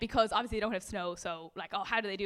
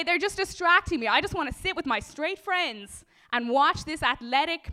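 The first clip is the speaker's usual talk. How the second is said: high, mocking rant climbing higher and louder; mock Putin; 6 semitones higher, 5 dB louder than usual. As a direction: ham up a blustering strongman getting shriller. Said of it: "Putin's up there like".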